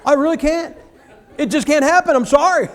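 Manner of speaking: gleefully refuse, laughing; laughing retort. Retold or imitated. Preacher answering the angry man, laughing and high.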